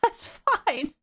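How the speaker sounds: high, gasping laugh into the words. Retold or imitated. Imitating dog walker laughing off a scare, gasping laugh. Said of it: "kind of laughing in that gasping way".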